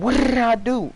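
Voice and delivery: exaggerated high, loud wail; hammed-up innocent yelp, way up high; joking mock outrage. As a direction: squeal it in over-the-top protest. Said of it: "No, but dead ass".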